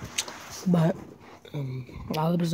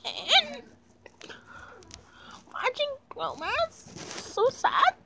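much higher put-on friend voice; high girly voice; 11 semitones higher, slower than usual. Kid playing sad best friend Brittany. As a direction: pitch up into a squeaky character.

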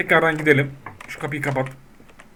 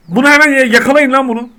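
high, loud call to catch him; urgent shout; 7 semitones higher, 6 dB louder than usual. Real, not acted. Banker shouting for guards to catch a fraudster. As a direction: yell for the guards.

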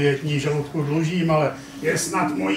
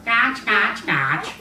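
imitating a duck's quack; mock duck call; 6 semitones higher, much slower than usual. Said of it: "vykřiknu".

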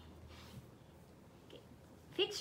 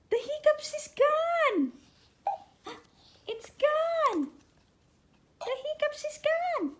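excited cheer; 7 semitones higher than usual. Real, not acted. Cheering hiccups gone.

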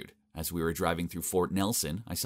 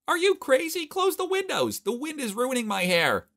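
put-on high shrill-free shriek of a girlfriend, much higher; Mila shouting; 4 dB louder than usual. A narrator's reading. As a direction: yell about her hair in a high girl voice. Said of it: "as she shouted".

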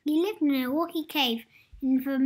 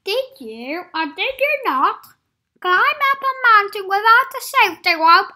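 loud, higher accusing questions; stern interrogation; 6 semitones higher, 13 dB louder than usual. Acted. Mister Safe grilling Adventurous, stern accusing. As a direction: grill him like a prosecutor.

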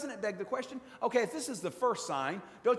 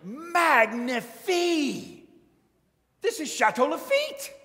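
loud, high, slow French-flavoured gush; amazed maitre d'; 9 semitones higher, 8 dB louder, slower than usual. Retold or imitated. Maitre d' tastes the water-turned-wine, delighted loud French gush. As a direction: savour it like a stunned wine steward. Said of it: "and he goes".

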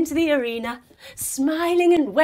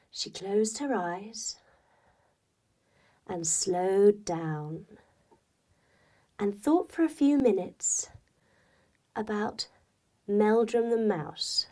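sleepy slowing; 3 semitones lower, 3 dB quieter than usual. A narrator's reading. Cat falling asleep.

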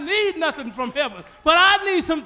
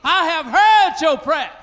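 louder shouted repeat; shouted proclamation; 3 semitones higher, 8 dB louder, slower than usual. Acted. Preacher shouting God's words to the church. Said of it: "I wish I had a praying church".

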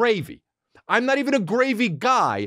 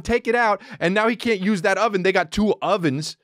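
usual speaking voice

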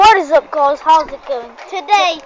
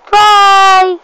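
loud bye; 3 semitones higher, 9 dB louder than usual. Real, not acted. Signing off the video.

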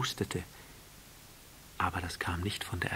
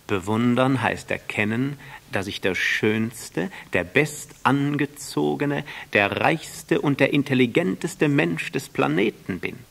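louder, slow and pompous boast; boasting vain man; 6 semitones higher, 7 dB louder than usual. A narrator's reading. The vain man defining admiration to the prince, pompous and loud. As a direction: relish each superlative.

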